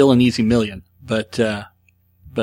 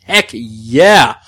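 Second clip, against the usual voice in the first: hyped cheer; 7 dB louder than usual. Acted. Hyping the segment.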